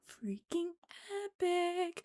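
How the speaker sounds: hushed, breath-held amazement; soft, reverent fan gush; awed whisper. Real, not acted. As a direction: whisper it in sheer awe. Awed by the staging.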